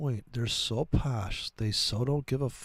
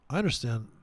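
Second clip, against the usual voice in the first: ordinary delivery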